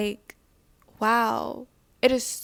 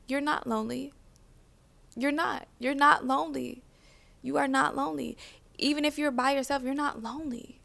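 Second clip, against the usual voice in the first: gentle reassurance; 3 semitones higher, 6 dB quieter than usual. Real, not acted. Speaking to her own inner child, softly and reassuringly. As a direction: talk your lonely self down, gently and softly.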